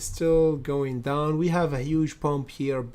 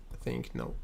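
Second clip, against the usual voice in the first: breathy whispered no, barely there; whispered aside; 9 dB quieter than usual. Real, not acted. Streamer whispering a quick answer to a chat question.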